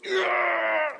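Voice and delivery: put-on loud high groan of a hurt boy; retold injured kid's yell; pained groan. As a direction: let out a sharp cry of pain. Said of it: "he's going".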